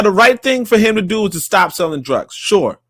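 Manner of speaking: ordinary delivery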